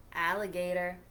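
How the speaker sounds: ordinary delivery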